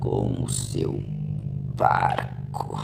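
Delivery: low, slow, half-whispered; resolute last words. Acted. Dying Mark's last brave words.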